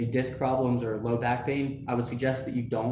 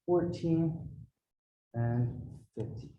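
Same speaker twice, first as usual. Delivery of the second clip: last reps counted under effort; counting while exercising; 5 semitones higher, 5 dB quieter, much slower than usual. Real, not acted. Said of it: "I'm still pushing down the tops of my arms".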